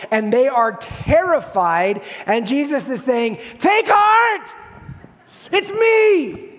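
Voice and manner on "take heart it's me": high, full-force shout; screaming over chaos. Acted. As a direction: yell to be heard over a roaring storm. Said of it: "He's screaming over the chaos".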